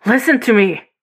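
high, loud, desperate demand; urgent, frightened yell; mean, scared shout. A narrator's reading. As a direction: bite it out, angry from fear. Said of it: "extra mean, like he gets when he's really scared".

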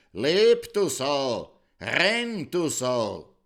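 loud, high, furious yell at the dead sow; shouting. Acted. King Purzelbaum beating the dead sow, shouting furiously. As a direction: scream it at the pig to get up. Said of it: "und geschrien".

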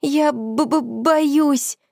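high, stammering through chattering teeth. A narrator's reading. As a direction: shiver the words out, stuttering. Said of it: "клацала зубами Танюсик".

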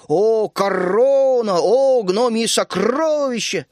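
slow, loud, theatrical mock exclamation; mocking legends with slow grand exclamations; mock grandeur. A narrator's reading. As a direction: ham up the fairy-tale awe. Mocking fairy-tale grandeur.

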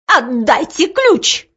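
high, drawn-out demand; fierce demand. A narrator's reading. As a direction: demand it, stretching the words. Seizes shoulder demanding the key.

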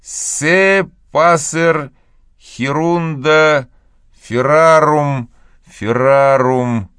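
slow, drawn-out schoolboy recitation. A narrator's reading. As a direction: recite the Latin slowly. Tom reciting Latin.

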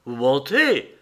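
loud, sharp outburst rising then dropping; shouted accusation. A narrator's reading. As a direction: cry out in denial. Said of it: "cria James Venn".

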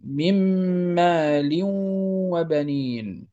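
"من مال وبنين" drawn out, held nasal joins between all words; إدغام demo. Shown as a demonstration. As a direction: recite "من مال وبنين" merging n into m and tanween into w. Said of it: "يصبحان حرفا واحدا مشددا". ("من مال وبنين" بإدغام وغنة ممدودة)

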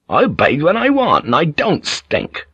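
fuming sailor's refusal. A narrator's reading. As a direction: push back angrily, stretching the last words. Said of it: "Blackthorn fumed".

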